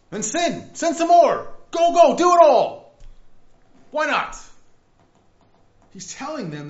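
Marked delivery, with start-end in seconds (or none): 0.1-4.4 much louder, higher sarcastic goading; mocking taunt. Prophet taunts the people, loud and mocking. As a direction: egg them on with a sneer. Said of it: "he's mocking them".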